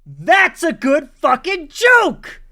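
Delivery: thrilled shout at a joke he loves; excited shout. Real, not acted. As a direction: belt it out in real delight. Excited reaction to clip joke.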